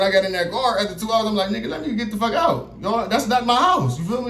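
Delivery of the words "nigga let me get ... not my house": high, indignant protest; pitched-up insistence he's not going there; refusing to be taken to that house, high and indignant. Retold or imitated. Arguing in the police car.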